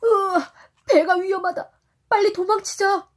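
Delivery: high, loud frightened cry; panicked shout. Acted. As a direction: yell to flee in panic. Sailors flee the whale. (겁에 질려 외치기)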